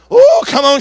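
sudden high-pitched oh, come on; high exhort. Real, not acted. Preacher rousing the congregation, sharp high cry. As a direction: yelp it up to rouse them.

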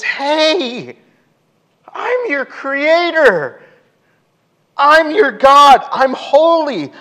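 high, loud, drawn-out proclamation; God, forceful. Acted. God answering complaining people, forceful drawn-out cry. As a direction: cry it out slowly, at full force.

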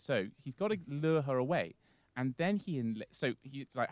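ordinary talk

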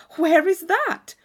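breathless with wonder; young girl longing for a castle; eager question. Acted. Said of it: "cried Catherine".

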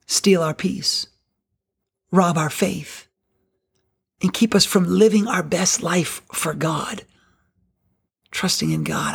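ordinary delivery

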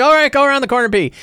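high, hurried, fed-up reply; exasperated scout leader. Acted. Scout leader sending a kid off, hurried and exasperated. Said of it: "He's like".

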